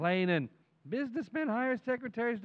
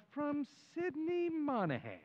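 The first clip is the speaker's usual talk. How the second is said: reading label; 8 semitones higher, 4 dB quieter, slower than usual. Retold or imitated. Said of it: "Says on the outside".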